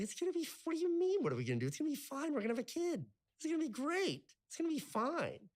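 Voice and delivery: high, bright-eyed reassuring voice; naive reassurance. Retold or imitated. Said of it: "And I was like".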